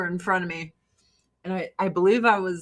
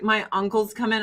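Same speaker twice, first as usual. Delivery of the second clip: normal talking voice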